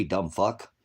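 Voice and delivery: ordinary talk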